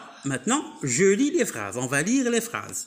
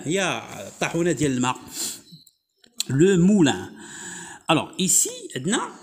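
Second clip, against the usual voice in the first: usual speaking voice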